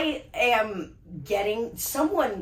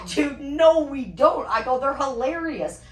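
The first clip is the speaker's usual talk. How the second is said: indignant protest; 6 semitones higher, 5 dB louder than usual. Retold or imitated. Arguing with cousin about farts.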